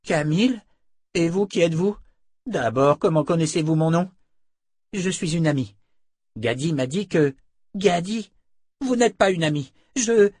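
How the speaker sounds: put-on a teenage boy challenging strangers; suspicious boy. A narrator's reading. Boy confronting the women, high and suspicious.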